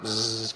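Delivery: low buzzing lips imitating a motorized chair; quiet electric whirr; buzzing wheelchair noise. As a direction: hum it like a mobility scooter. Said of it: "My impression of".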